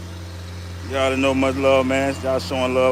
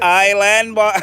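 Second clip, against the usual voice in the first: put-on higher, louder Caribbean accent; 7 semitones higher, 3 dB louder, slower than usual. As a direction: say it like a Caribbean island boy. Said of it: "I tell joke in the island voice".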